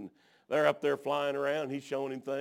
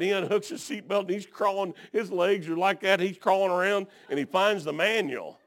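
ordinary delivery

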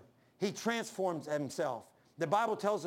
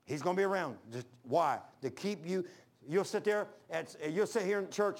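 ordinary talk, nothing marked

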